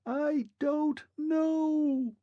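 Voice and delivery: a fading machine man, shouting from afar; distant thin shout. A narrator's reading. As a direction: call out from a long way off. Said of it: "like someone shouting in the distance".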